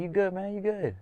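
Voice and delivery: high, concerned asking; checking on a dazed fighter; high anxious question; worried check-in. Retold or imitated. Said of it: "I'm like".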